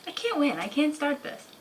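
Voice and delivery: panting after running upstairs; breathless, catching your breath between words. Real, not acted. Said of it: "out of breath from running upstairs".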